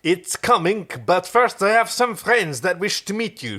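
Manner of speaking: put-on a light French accent; lightly French alien dealer. Kritz answering Chiana in a light French accent. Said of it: "doing a pure French accent".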